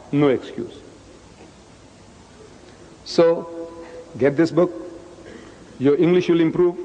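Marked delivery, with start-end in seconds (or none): none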